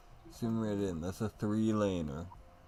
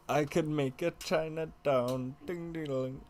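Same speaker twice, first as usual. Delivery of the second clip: high playful voice; 10 semitones higher than usual. Pretend. Playful Chinatown bit.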